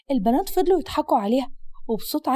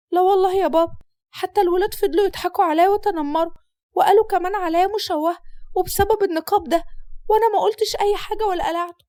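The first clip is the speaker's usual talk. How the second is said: put-on higher, earnest girl's voice; pleading daughter; 6 semitones higher than usual. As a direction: insist she kept it on. Daughter defends herself.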